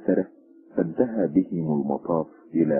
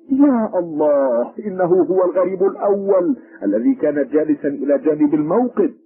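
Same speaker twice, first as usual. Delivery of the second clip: bewildered; 13 semitones higher, 6 dB louder than usual. A narrator's reading. Policeman realising it was the first stranger, loud bewildered cry. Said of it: "فقال الشرطي في حيرة".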